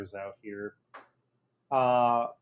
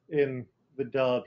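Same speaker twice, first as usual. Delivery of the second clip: ordinary talk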